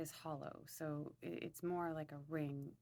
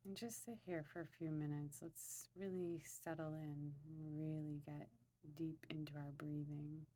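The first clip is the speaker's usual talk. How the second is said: guided meditation; 5 dB quieter than usual. Acted.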